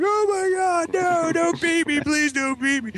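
very high, drawn-out mock-terrified plea; begging kid. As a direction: plead in falsetto like a panicking child. Kid begging before a beating.

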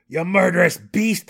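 loud, high angry shout; raging gnome cursing a killer; furious curse. A narrator's reading. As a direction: spit the curse at the wraith. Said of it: "the Burrow Warden cursed".

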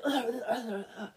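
spluttering, gargled "ugh" noises; mock gagging. Blacksmith with a dumpling in his mouth, mock gagging. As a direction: splutter as if food flew in your mouth.

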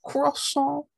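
very high, nasal French "Croissant" with silent t; French accent. Shown as a demonstration. Host asks co-host to say croissant, exaggerated French accent. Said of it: "say croissant".

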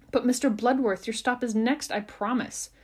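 high, loud call from inside. Acted. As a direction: call out across the bus. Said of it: "called the same voice from inside".

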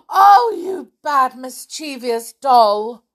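high, loud gasped reproach; exclaimed scolding. Acted. A toy telling off a naughty doll, scolding. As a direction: scold with a gasp, then slow down.

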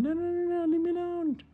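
very high squealing gecko; cartoonish high-pitched panic; panicked protest. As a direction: squeal to be left alone. Gecko pleads to escape the boy.

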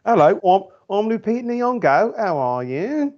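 exaggerated British accent, very high-pitched; mock British, shrieky and over-polite; Lupita Nyong'o. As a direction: do a silly, hammed-up British introduction. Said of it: "completely superfluous British accent".

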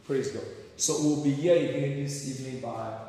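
ordinary talk

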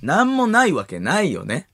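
sing-song knowing insinuation; 関明夫 impression. Pressing the partner who denies the rumour, insinuating impression. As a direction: stretch each claim into a wavy leading question. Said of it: "まだ関明夫やってた".

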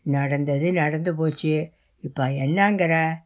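low-pitched voice of a village father; father cutting in. A narrator's reading. Father interrupting his daughter, low and firm. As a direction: cut in firmly, pitched well down. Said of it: "Ramaiah மகளை இடைமறித்து பேசினார்".

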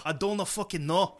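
loud, high hammed-up Irish brogue; mock Irish accent. Host bantering with co-host, mock Irish accent. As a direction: trade it back in put-on Irish.

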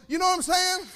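high, loud call to the congregation; preacher's shout. Real, not acted. Preacher tops off his joke.

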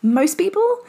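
usual speaking voice

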